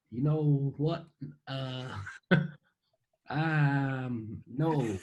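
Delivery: hesitant put-on American accent. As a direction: stall in an assumed American accent. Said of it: "in an American accent".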